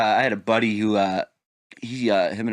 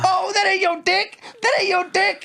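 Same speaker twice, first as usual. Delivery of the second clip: mock indignation. Wife seeing her dildo drop out, mock high indignation. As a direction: stretch out the accusation up high. Wife mocks caught man.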